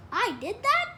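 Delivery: high, loud, rising amazed question; suddenly interested. A narrator's reading. Small boy hearing of his own mischief, suddenly interested. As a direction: jump up in pitch with delighted disbelief. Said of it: "suddenly interested".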